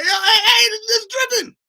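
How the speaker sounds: high squealing shout of alarm; mock panic, shrieking high; panicked yelp. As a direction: yelp like the trash juice just hit you. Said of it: "You like".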